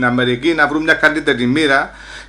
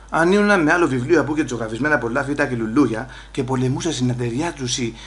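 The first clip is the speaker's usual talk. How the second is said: ordinary delivery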